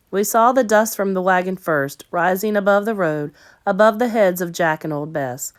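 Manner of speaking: ordinary delivery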